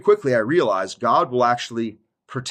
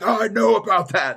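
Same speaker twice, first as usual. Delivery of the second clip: mock-indignant mom, higher and louder; huffy parent insisting she knows; imitating angry mom; 10 semitones higher, 4 dB louder than usual. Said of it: "She gets, like, angry and starts being like".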